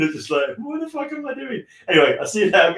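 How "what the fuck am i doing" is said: lightly voiced, much higher and softer; a hint of a baffled cartoon figure; bewildered character. Host mimicking a confused character from the clip, high and soft.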